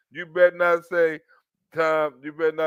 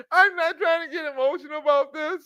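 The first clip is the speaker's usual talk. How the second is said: mock tearful; 8 semitones higher than usual. Mocking crying celebrity.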